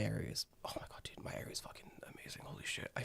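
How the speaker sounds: breathy whisper, almost silent. Real, not acted. Telling his friend about his amazing area in a whisper. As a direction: hush it like sharing a secret. Excited secret aside to friend.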